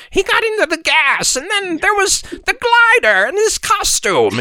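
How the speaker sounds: creepy, very high goblin voice; high witchy villain impression; Green Goblin. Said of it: "sounded so creepy and goblin-y".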